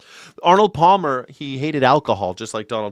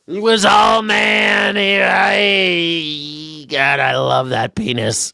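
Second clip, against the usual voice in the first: overacted mock gush; 5 semitones higher, much slower than usual. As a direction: ham up the adoring tribute. Mocking Trump, overacted.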